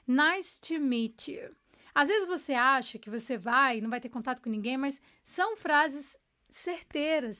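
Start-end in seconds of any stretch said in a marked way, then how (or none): 0.1-1.5 slow model phrase. Shown as a demonstration. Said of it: "saber falar prazer em conhecer você".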